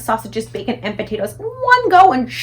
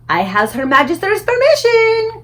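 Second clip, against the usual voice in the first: much higher, louder giant triumph; gleeful giant cry; 11 semitones higher, 7 dB louder than usual. A narrator's reading. The BFG crowing at the Queen's permission, high and loud. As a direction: crow it like the giant. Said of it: "cried the B F G".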